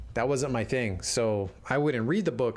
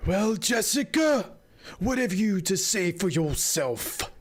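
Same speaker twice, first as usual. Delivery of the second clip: put-on slow, much higher stern old woman; Reverend Mother; 7 semitones higher, slower than usual. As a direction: demand an answer from above. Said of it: "asked the Reverend Mother".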